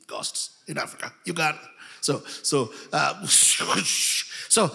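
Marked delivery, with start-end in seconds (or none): none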